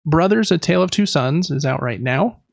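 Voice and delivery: normal talking voice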